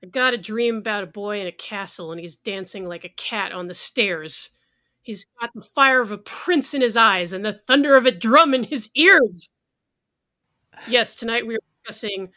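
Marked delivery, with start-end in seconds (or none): 0.0-9.5 theatrical declamation, swelling loud at the end; dramatic poetry recital. Acted. Host opening the show with [name]'s lyrics as poetry, dramatic recital. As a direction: recite it grandly, building to loud peaks.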